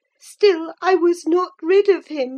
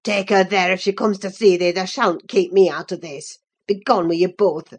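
low, gruff master ordering them out; low voiced furious Earnshaw; angry Earnshaw; 10 semitones lower than usual. A narrator's reading.

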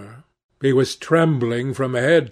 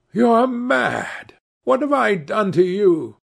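high-pitched, anxious pleading; alarmed protest; 7 semitones higher than usual. A narrator's reading. Cornered man to the gunman, alarmed protest.

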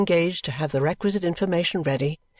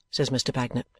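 ordinary delivery, nothing marked

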